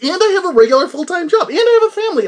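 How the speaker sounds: AND I have, punched high and loud; emphatic listing. Real, not acted. Host piling up his duties to listeners, emphatic.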